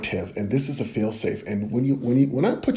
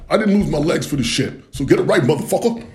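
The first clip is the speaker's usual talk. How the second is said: loud, higher, furious veteran heckling; angry heckler voice; 5 semitones higher, 5 dB louder than usual. Pretend. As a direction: bark it like an offended audience member. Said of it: "there's someone in the audience like".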